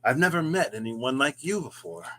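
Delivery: American accent, played as a dramatic line. Shown as a demonstration. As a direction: say it like an American melodrama lead. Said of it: "I'll do an American one".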